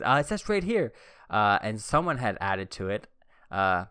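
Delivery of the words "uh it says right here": pitched-up mock teacher insisting; a hint of a pointed teacher. Teacher pointing at the list, mock insisting. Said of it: "And she goes".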